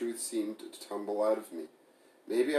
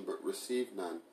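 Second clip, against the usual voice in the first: plain reading voice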